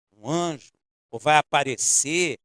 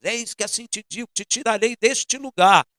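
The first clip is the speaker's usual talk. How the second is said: a touch of a praying sister, rising and louder; lightly voiced praying woman, getting louder; mimicking a tongues prophecy; 7 semitones higher, 7 dB louder than usual. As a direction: ham up a prophetic sister's declaration. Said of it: "Começou a orar em línguas".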